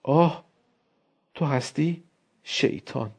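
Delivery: lightly voiced teasing wife; soft amused greeting; Elena greeting softly. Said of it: "با دیدن من گفت".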